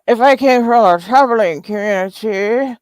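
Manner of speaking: exaggerated put-on voice quoting the rival; mocking imitation of rival. Streamer repeats Susan's muddled claim, mocking imitation. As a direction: parrot her line back in a mock voice. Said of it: "talking all slurring and shit".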